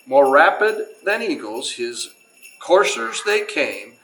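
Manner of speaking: put-on reading a Christmas poem aloud to children, lilting; storyteller voice, unhurried and sing-song; story narration. Museum host reads to the kids in a storyteller voice.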